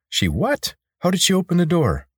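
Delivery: incredulous questions climbing high; shocked disbelief. Acted. Corvin learns Kate left through the labyrinth, shocked.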